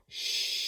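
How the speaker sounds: long hushing "Shh"; sh sound. Shown as a demonstration. Teacher showing children what two letters say, long "Shh" sound. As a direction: draw out an unvoiced "Shh". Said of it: "they say".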